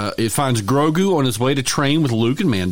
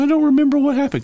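confused listener voice; 3 dB louder than usual. Retold or imitated. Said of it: "a lot of you might be going".

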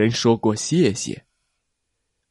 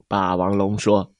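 ordinary delivery

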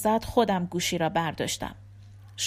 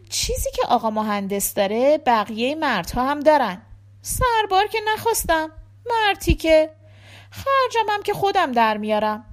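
higher-pitched seamstress cutting in to scoff; brash dressmaker; 6 semitones higher, 4 dB louder than usual. A narrator's reading. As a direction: play a blunt dressmaker dismissing men.

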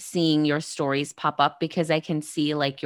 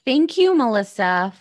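bright thanks; 6 semitones higher, 6 dB louder than usual. Real, not acted. Host's warm closing thanks.

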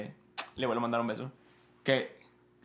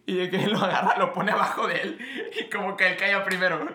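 louder, higher talk through laughter; higher, louder storytelling broken by laughter; laughing while telling; 6 semitones higher, 4 dB louder than usual. Real, not acted. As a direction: retell the scene while cracking up.

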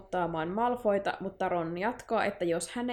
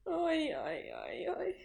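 pained groan; 8 semitones higher, 8 dB quieter, much slower than usual. Real, not acted. Groaning at book length.

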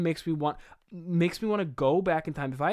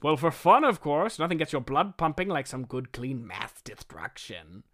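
hammed-up, gleeful villain; cartoon bad guy showing off; Shocker villain voice. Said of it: "Shocker says".

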